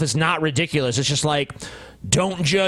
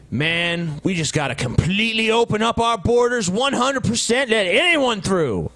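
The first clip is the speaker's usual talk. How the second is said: mock advocate; 6 semitones higher than usual. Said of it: "almost no one is like".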